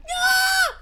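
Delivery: short, very high, loud squeal; piercing happy "ah"; excited squeal. Acted. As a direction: shriek with delight. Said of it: "that's why I'm like".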